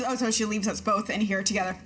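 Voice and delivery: ordinary delivery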